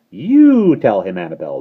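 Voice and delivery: loud, slow croak. Acted. Starling croaking slowly for the baby to answer. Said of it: "the starling croaked".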